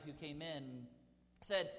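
ordinary delivery

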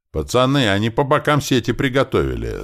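high, loud warning; yelling. Acted. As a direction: yell a warning to the lads. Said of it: "заорал кто-то из наших".

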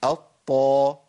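"الطا" as a held double ط into long a; heavy "aṭ-ṭaa"; doubled ṭ with fatha. Shown as a demonstration. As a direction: press the ط, then open it. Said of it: "الحالة الخامسة".

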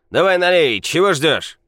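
put-on loud drunken demand. A narrator's reading. Drunk demands more drink.

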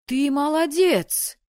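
high, loud, drawn-out congratulation; excited praise. A narrator's reading. Friend rushes up to congratulate the winner, elated cheer. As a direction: stretch the praise out with delight.

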